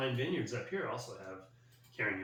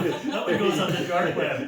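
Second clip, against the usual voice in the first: louder, slower, laughing; deadpan joke dissolving into laughter; 3 semitones higher, 9 dB louder, slower than usual. Real, not acted. Host jokes the clip goes to the dark web, laughing. Joking about cutting the recording.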